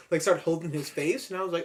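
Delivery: ordinary talk, nothing marked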